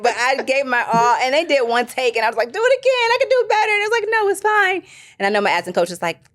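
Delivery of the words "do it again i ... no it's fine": eager pleading. Retold or imitated. Retold pleading with the director.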